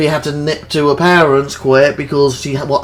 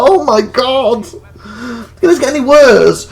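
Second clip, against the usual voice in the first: exasperated dismay; 9 semitones higher, 4 dB louder than usual. Retold or imitated. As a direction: wail it in mock despair, building louder. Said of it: "I'm like".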